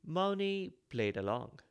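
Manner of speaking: ordinary delivery, nothing marked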